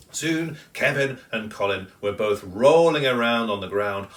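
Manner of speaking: plain reading voice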